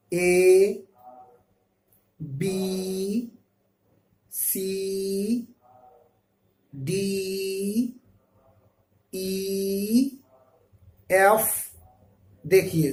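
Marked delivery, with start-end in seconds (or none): 0.1-10.1 A, B, C, D, E spaced out for pupils; letter names for repeating. Shown as a demonstration. Teacher models letters for students, each said slowly apart. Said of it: "پڑھیے".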